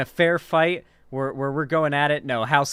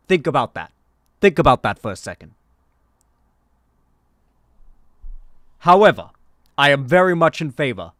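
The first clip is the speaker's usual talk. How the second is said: emphatic campaign-speech impression; Bernie; 4 dB louder than usual. Candidate addressing gun violence on a debate stage, emphatic Bernie impression. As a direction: hammer each phrase, then pause for weight. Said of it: "I'm doing a Bernie impression".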